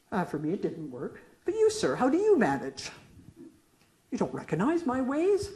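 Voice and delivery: usual speaking voice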